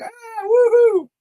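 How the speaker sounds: high, loud, stretched whoop of delight; joyful kid whoop. Retold or imitated. Psychic imitating kids riding a shopping cart, gleeful whoop. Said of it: "kids ... riding the shopping cart".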